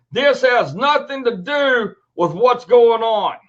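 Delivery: ordinary delivery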